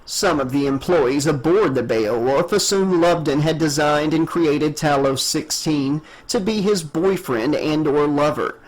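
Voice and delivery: ordinary delivery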